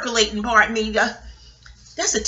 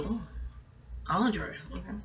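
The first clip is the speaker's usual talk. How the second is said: impressed quiet; 5 semitones lower, 14 dB quieter than usual. Retold or imitated. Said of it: "I said".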